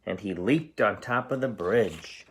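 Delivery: ordinary delivery, nothing marked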